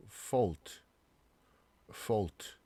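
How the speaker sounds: "fault" as fawlt; "fault" with one aw vowel. Shown as a demonstration. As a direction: say "fault" with a rounded aw. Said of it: "이 어 발음이 나요".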